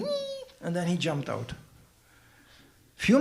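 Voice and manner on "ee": high soft monkey squeak; tiny high shriek of a monkey; imitated high monkey cry; monkey screech. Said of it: "the monkey was going".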